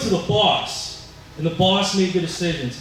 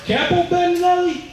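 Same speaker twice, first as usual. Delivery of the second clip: high, incredulous question; astonished recognition; 11 semitones higher than usual. Acted. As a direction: say the name with mock astonishment, pitched way up. Boss reacts to a feared family name.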